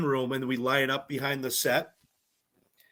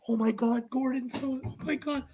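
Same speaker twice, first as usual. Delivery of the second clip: high, hushed mock gushing; hushed starstruck imitation; imitated starstruck fans; 9 semitones higher, 4 dB quieter than usual. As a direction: gush softly and high, like a fan backstage. Contestants awed, waiting backstage.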